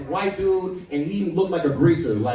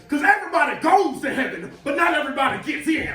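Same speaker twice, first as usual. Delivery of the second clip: preacher voice; 10 semitones higher than usual. Retold or imitated. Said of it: "the pastor said".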